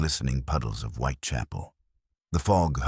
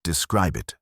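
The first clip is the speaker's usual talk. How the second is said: sharp. A narrator's reading. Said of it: "Holmes' voice was suddenly sharp".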